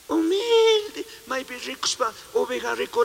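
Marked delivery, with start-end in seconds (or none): 0.1-1.1 mock meek coo. Mocks the wolf's meek look.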